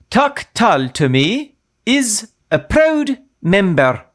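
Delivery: a wobbly stab at Irish, drawn out; attempted Irish accent. Cohost says it sounds like Mrs Doubtfire, wobbly Irish-Scottish accent. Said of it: "You sound like Missus Doubtfire".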